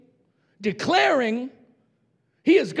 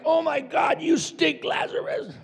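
higher, mock-disgusted squeal; crowd's disgust; 5 semitones higher, 3 dB quieter than usual. Onlookers recoiling from Lazarus's smell, mock disgust. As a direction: play the grossed-out onlooker for laughs. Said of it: "They're like".

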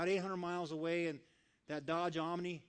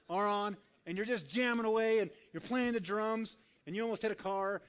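loud, higher, revved-up storytelling; excited buildup; 5 semitones higher, 4 dB louder than usual. Retold or imitated. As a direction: get carried away by the music. Excitedly acting out jamming in car.

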